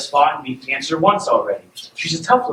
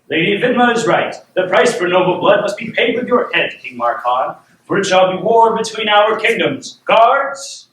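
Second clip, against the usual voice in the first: higher, forceful storybook king; acted outraged king; angry royal decree; 6 semitones higher, 5 dB louder than usual. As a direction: pronounce war, rising to a call for guards. Plays angry king passing sentence.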